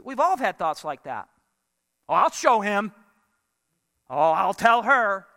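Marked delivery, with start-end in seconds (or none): 2.1-5.3 lightly acted vengeful muttered vow, pushed out firmly; hammed-up score-settling threat; mock vindictive. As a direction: play at plotting payback. Acts out vengeful private thoughts.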